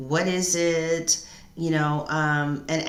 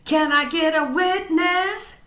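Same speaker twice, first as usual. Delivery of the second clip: high, loud churchy call-out; preacher call; 11 semitones higher, 6 dB louder than usual. Acted. Reader calling out to her live viewers, preacher style. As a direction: call out to the congregation.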